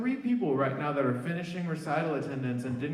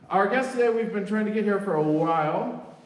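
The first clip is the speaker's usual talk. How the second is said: louder, higher, eager introduction; excited announcement; 4 semitones higher, 6 dB louder than usual. Real, not acted. Host to the audience, introducing the guest with excitement.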